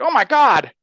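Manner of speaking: retold agents blurting it out in a rush; frantic. Agents react after interviewing the suspect, frantic. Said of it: "frantic, like, response".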